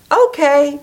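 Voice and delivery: high, loud delighted "Okay"; eager okay. Acted. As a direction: chirp it happily before running off. Little one agrees and runs off.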